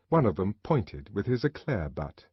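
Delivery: usual speaking voice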